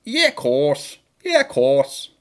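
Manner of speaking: drawn-out, harsh Scouse catchphrase; put-on Scouse accent. Shown as a demonstration. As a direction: do a short burst of exaggerated Scouse. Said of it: "I can do in Scouse is if I go".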